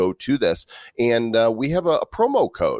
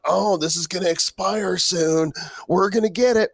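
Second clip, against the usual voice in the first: mocking squatters; 9 semitones higher than usual. Host mocking the domain squatters, high and gloating. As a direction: sneer it like a scheming cartoon villain. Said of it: "domain squatters who thought".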